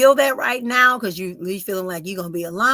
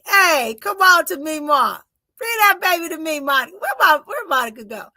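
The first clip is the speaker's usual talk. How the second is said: excited calling out; 6 semitones higher, 7 dB louder than usual. Real, not acted. Psychic calling for Monica to bring the baby, excitedly loud. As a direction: call out across the room, thrilled.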